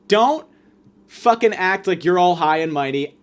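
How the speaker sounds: normal talking voice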